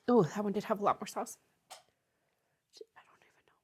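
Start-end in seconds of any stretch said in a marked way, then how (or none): 2.7-3.5 breathy whispered aside; whispering. Real, not acted. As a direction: whisper it to yourself. Whispering, mouth on fire.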